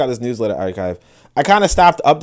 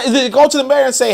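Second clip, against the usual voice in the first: normal talking voice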